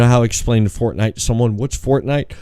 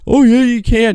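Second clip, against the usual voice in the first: much higher, louder, eager insistence; mock insistent friend; 11 semitones higher, 4 dB louder than usual. Friend insisting you can survive, mock insistent. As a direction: play the stubborn fan. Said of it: "And he's like".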